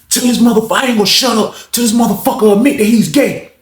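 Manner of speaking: high-pitched stubborn outburst; defiant rant. Retold or imitated. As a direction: refuse to back down, heated and high. Acts old head refusing to stop.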